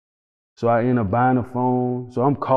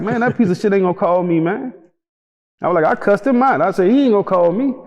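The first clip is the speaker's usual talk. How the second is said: higher scornful rant; 7 semitones higher, faster than usual. Retold or imitated. To the guard about his trial lawyer, higher and scornful. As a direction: spit it out higher.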